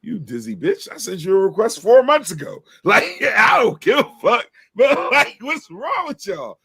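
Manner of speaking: high-pitched, incredulous ranting; exasperated rant. Real, not acted. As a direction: rant up high, stretching the punch lines. Rant over friend requests.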